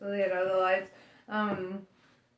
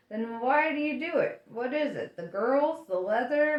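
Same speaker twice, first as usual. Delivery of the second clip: ordinary delivery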